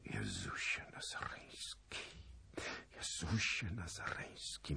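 hushed, whispered prayer; quiet voice. A narrator's reading. King covering his eyes at the grim news, quiet voice. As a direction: breathe it out softly, almost soundless. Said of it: "mówił cichym głosem".

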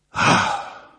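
loud voiceless "ach"; dismayed gasp. A narrator's reading. Zagloba left alone with bad news, heavy gasp. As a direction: heave out a heavy breath of dismay.

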